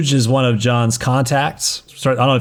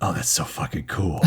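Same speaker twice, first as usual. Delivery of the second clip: hushed awe; 7 semitones lower, 9 dB quieter than usual. Retold or imitated. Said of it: "all of them are just like".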